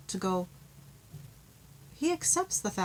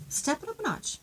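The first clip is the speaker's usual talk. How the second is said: higher repeat; 8 semitones higher than usual. Shown as a demonstration. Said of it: "That came across".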